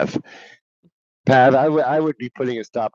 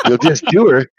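louder, higher laughing exclaim; 7 semitones higher, 7 dB louder than usual. Real, not acted. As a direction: laugh it out, shocked. Laughing at the joke.

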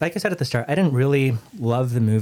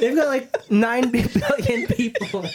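laughing; 11 semitones higher, slower than usual. Real, not acted. Group laughing fit.

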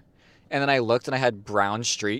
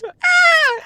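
shrill high yelp of a tiny alien; cartoon alien scream; 11 dB louder than usual. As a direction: squeal like a cartoon alien being crushed. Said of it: "and he goes".

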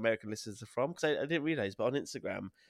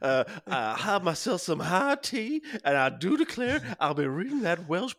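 Foghorn Leghorn; 7 semitones higher, 5 dB louder than usual. Acted. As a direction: draw it out in a hammed-up Southern accent. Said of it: "Just doing Foghorn Leghorn kind of".

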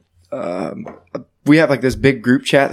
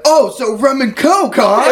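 high slow knowing tease; knowing girlfriend; 10 semitones higher, slower than usual. Retold or imitated. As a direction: drawl it like you caught him. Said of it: "She said".

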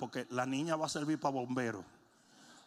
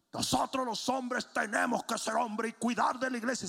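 higher, rallying speech; mock exhortation; 7 semitones higher, 4 dB louder than usual. Preacher rallying the men at the warehouse, higher exhorting voice. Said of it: "estoy diciéndole a todos".

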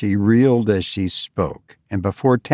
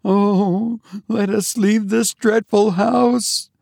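high, moaning plea; frightened sister moaning higher; 11 semitones higher, slower than usual. A narrator's reading. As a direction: beg to flee the house. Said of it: "moaned Eunice".

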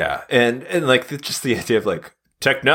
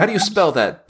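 normal talking voice

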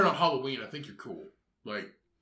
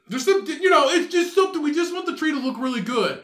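higher, louder, hurried excuse with a laugh; flustered customer; 8 semitones higher, 5 dB louder, faster than usual. Acted. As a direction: justify the order in a high, flustered voice. Acted customer justifies awkward order.

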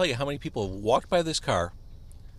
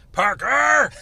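shouted high and loud, PARKER! yelled; 12 semitones higher, 9 dB louder than usual. Shown as a demonstration. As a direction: yell Parker! with full force. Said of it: "Is that better?".

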